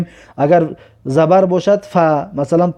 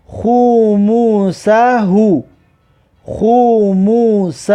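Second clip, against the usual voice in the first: slow syllables with held vowels; drawn-out model reading; slower than usual. Shown as a demonstration. Teacher models a word for learners, slow and drawn out. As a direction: stretch "خوموسهو" syllable by syllable. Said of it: "و در این جمله".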